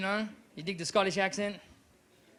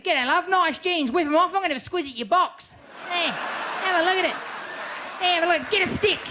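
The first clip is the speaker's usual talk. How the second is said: raised-voice, broad Aussie chat-up; crass Aussie accent; 8 semitones higher than usual. Acted. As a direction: holler it like a crude Aussie bloke. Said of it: "Doesn't work if you're an Aussie".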